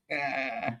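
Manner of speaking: quiet, short chuckle. Real, not acted. Debater teasing Chef about last night, quiet chuckle. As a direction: chuckle softly to yourself. Laughs after taunting Chef.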